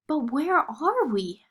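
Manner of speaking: high, slow amazed question; wondering girl. A narrator's reading. Marie asking the prince where they are, amazed. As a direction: ask it in wide-eyed wonder. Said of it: "asked Marie".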